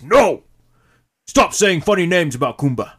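very loud, high angry yell; furious shout. Acted. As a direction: scream it, broken English. Kumba yelling in quarrel.

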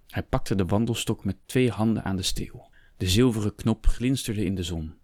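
ordinary delivery

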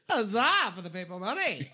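loud, high "Huzzah" for the money; hammed-up medieval shout; mock vendor cheer.

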